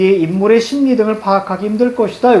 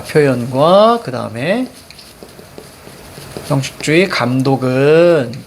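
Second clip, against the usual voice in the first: slow, stretched phrase endings; teacher slowly modelling how to read; chunked reading demo; 4 semitones lower, slower than usual. Shown as a demonstration. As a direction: draw out the end of each chunk. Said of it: "어떻게 읽어야 돼요?".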